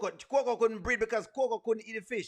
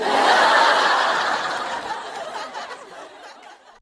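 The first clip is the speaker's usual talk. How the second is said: high, loud cackle bursting out; cracking up at her own story; loud cackling laugh; 14 semitones higher, 9 dB louder than usual. Real, not acted. Laughing hard to her viewers at the gossip.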